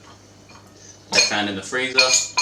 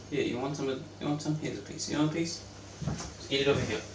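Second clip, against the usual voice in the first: hushed, softer coax to the pet, half whispered; 2 semitones higher, 10 dB quieter, faster than usual. Acted. To the pet offering a piece of onion, hushed coaxing. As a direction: lure the pet gently with a piece.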